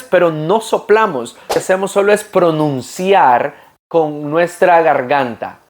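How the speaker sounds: ordinary talk, nothing marked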